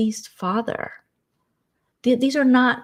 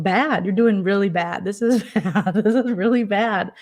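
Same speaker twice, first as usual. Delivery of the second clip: laughing. Retold or imitated. Answering Amber, laughing.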